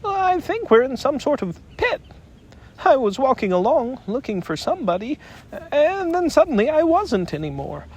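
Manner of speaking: a timid little animal explaining what happened; small anxious Piglet. Acted. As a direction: Piglet telling Pooh how he fell into the pit. Piglet voice held.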